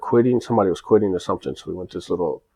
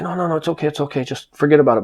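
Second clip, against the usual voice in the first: higher-pitched, urgent appeasing; hurried placating; 8 semitones higher, faster than usual. Retold or imitated. Owner telling employee to let it go, imitated placating. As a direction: imitate someone rushing to smooth things over. Owner calming him down, retold.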